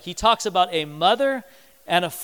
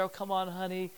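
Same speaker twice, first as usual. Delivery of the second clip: soft, hushed consoling; quiet imitation of motherly comfort; 10 dB quieter than usual. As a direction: soothe gently like a mom. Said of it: "your mother's always there".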